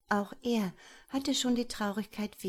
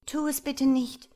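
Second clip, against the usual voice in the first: higher, louder plea; pleading; 6 semitones higher, 6 dB louder than usual. Acted. Girl begs the gnome to spare her sister, pleading. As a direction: beg him to hold off. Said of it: "bat Wehmut".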